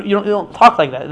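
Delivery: ordinary talk, nothing marked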